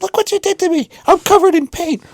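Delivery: very high, squealing mock hysteria; hammed-up wail of an outraged woman; crazy woman. Paint-covered woman complaining to the homeowner, mock hysterical. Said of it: "she was a crazy woman".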